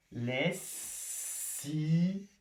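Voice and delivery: "Lessive" slowly as les, si, ve, cut between the two s; long hissed s in "Lessive", then si and ve apart; split into syllables. Shown as a demonstration. Teacher cutting the double consonant, "Lessive" in slow syllables. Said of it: "je vais venir couper au milieu".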